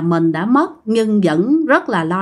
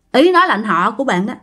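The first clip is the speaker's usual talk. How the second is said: plain reading voice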